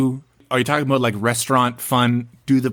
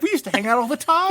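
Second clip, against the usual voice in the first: lifted way up, light mock coworker voice; high quoted voice; 14 semitones higher than usual. Host quoting the screenwriter in a high mock voice. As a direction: voice her brightly, pitched high.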